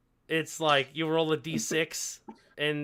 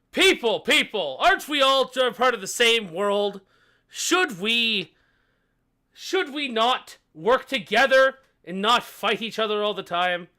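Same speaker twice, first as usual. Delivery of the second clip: loud, raised-pitch appeal to the room; pleading king; 6 semitones higher, 6 dB louder than usual. Acted. King appealing to warring rulers for peace, loud plea. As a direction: call the squabbling crowd to order.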